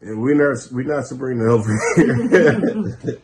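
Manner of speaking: loud, gleeful cry that the prank missed; excited outburst. Real, not acted. Host laughing to friends about wrong person, loud and gleeful. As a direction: shout it out, thrilled by the mix-up.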